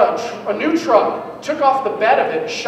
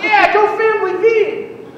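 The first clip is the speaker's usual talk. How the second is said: put-on sarcastic kid; 8 semitones higher than usual. Kid mocking vacation.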